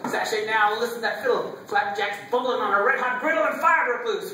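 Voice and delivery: high, driving dance caller's chant; rousing hoedown climax; square-dance calling. Acted. Square-dance calls at fiddling climax.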